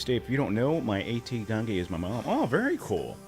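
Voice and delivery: ordinary talk, nothing marked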